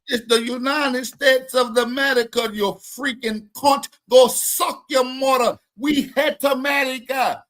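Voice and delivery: hammed-up angry Jamaican caller; mock Jamaican accent. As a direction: throw the insults in an exaggerated Jamaican accent. Mimicking the Jamaican caller's rant.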